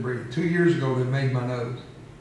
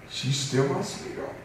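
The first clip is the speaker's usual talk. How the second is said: normal talking voice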